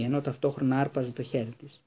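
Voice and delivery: ordinary delivery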